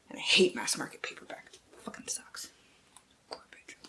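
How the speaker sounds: soft, unvoiced grumbling whisper; whispered rant. Real, not acted. Booktuber muttering about hating mass market paperbacks, whispering. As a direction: hiss your disgust under your breath.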